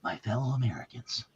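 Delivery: low, hushed presidential address voice; Ronald Reagan impression. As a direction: open like an old president on TV. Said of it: "remember Ronald Reagan used to start out like that".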